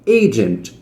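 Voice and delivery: "Agent" with the first syllable "A" pulled up and stressed. Shown as a demonstration. Teacher showing students the stressed syllable of "Agent", first syllable pushed up. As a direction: lift the start of "Agent". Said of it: "Puxa pra cima no começo".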